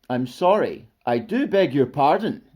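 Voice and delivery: lightly voiced polite monster; politely apologise, pitched a little high; not at all monstrous. A narrator's reading. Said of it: "not at all monstrous".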